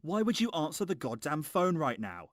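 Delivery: normal talking voice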